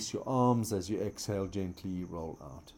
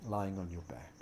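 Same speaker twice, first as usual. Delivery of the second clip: soft spa whisper; 10 dB quieter than usual. Acted. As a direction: ease them into stillness, growing softer. Guided relaxation in corpse pose.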